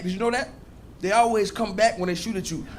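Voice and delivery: usual speaking voice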